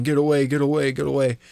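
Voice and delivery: ordinary delivery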